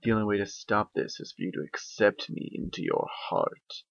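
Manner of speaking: sinister dark voice. Acted. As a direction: tempt her from the shadows. Dark voice continues.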